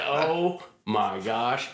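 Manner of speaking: slow, drawn-out amazement; stunned. Retold or imitated. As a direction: let the surprise sink in slowly. Stunned realization.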